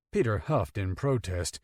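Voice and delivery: usual speaking voice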